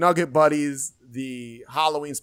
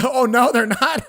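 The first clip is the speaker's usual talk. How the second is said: laughing it off; laughing, higher and louder; 9 semitones higher, 6 dB louder than usual. Real, not acted. Laughs at the wrong guess.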